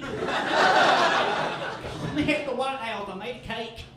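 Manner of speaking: slow, high goofy voice; lightly voiced goofy president; mock president. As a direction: ham up a silly call. Parody of mom.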